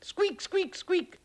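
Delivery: very high, loud squeaking; mouse squeaks. Acted. Mouse at the corn sack, high squeaks. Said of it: "thought the mouse".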